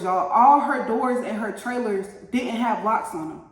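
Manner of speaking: ordinary talk, nothing marked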